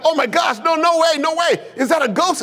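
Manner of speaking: high-pitched mock panic; freaking out. Disciples think they see a ghost, hammed-up freak-out. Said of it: "And they're freaking out".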